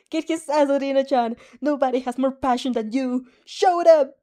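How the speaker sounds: loud, high cheering with a rising final yell; cheering shout. Acted. A boy cheering his girlfriend on the TV, loud shout. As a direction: roar your support at the screen.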